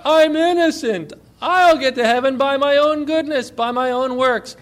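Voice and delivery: acted indignant cry, high and loud. Pastor voices people claiming innocence, crying out in protest. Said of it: "how many people cry out".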